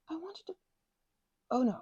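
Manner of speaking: ordinary talk, nothing marked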